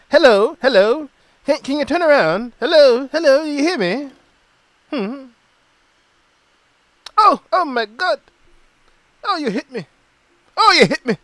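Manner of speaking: high, loud put-on London accent, calling out; [name] calling to the star in a mock London accent. Playful imitation of a London accent, pleading. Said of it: "was using a London accent".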